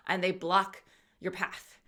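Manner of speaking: ordinary delivery, nothing marked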